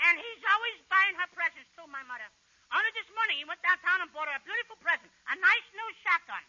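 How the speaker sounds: lightly voiced kid; a hint of small-boy chirp; innocent little boy. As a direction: play a sweet child setting up a joke. Costello plays little brother.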